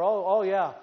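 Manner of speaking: ordinary talk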